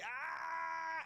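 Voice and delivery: sudden high fake yelp of pain; a hammed-up yelp as if struck; mock pained yelp. Acting out exaggerated overreaction.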